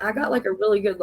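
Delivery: ordinary delivery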